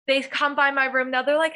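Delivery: ordinary talk, nothing marked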